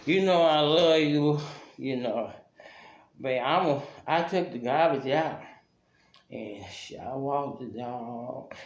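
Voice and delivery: low, slow, wheedling mock drunk; drunk man begging. Drunk boyfriend begging his woman for cash, low slow wheedling. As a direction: sweet-talk her for a few dollars.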